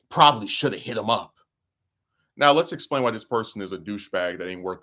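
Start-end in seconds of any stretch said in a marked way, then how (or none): 0.1-1.3 villain voice. Acted. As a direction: sneer it like a cartoon villain. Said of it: "my, my villain voice".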